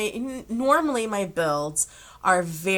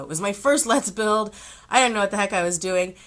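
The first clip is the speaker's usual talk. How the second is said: normal talking voice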